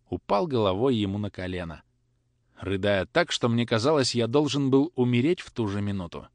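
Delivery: usual speaking voice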